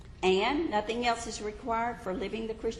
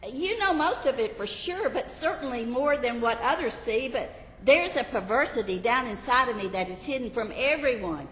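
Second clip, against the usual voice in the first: anxious confessor; 4 semitones higher than usual. Acted. Believer confessing to Jesus.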